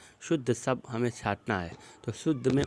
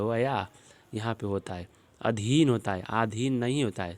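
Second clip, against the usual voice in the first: ordinary talk, nothing marked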